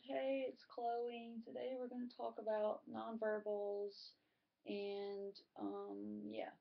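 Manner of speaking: slow, drawn-out, level delivery; laid-back, unengaged. Shown as a demonstration. Speaker showing students a poor introduction, laid-back and listless. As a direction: drag it out like someone lounging, no energy. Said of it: "If I were just laying up here".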